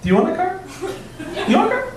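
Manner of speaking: hammed-up Oprah handing out cars; almost Oprah Winfrey. Comic to the crowd, a hammed-up high Oprah giveaway. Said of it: "an almost impression of Oprah Winfrey".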